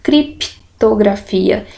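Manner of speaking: slow, crip kept together: crip-to-gra-fi-a; crip as one syllable. Shown as a demonstration. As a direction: sound out criptografia with crip joined. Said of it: "Porque aqui eu digo crip".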